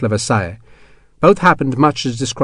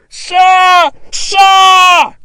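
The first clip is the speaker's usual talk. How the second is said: much higher, loud shouting; desperate shout; 7 dB louder than usual. Acted. Hexwell shouting after Sharp from the courtyard. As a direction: shout frantically for help. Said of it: "Hexwell shouted".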